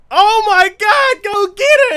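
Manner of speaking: high, loud, hammed-up panic; frantic shout. Viewer urging girl to rescue runner, frantic shout. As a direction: yell at the screen in desperation.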